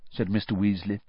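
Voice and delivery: usual speaking voice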